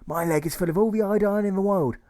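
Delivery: high, loud sing-out character voice; poorly patient calling out grandly; charming poorly patient. A narrator's reading. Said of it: "says with a certain charm".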